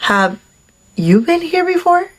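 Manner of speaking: high-pitched mock nosy question; mimicked crazy question. Date asking at the ice cream shop, mimicked nosiness. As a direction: imitate a maddening repeat question, lifted on "you". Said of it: "with the same crazy question".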